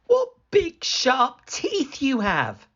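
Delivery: put-on mildly alarmed girl; alarmed little girl. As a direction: cry out in growing fear.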